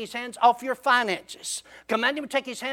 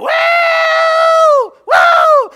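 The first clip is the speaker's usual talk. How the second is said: very high, loud, sustained scream; storyteller's full-voiced imitation scream; hollered whoop; 13 dB louder than usual. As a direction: wail it long like a man being mauled. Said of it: "John's hollering".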